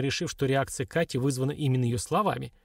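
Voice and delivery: plain reading voice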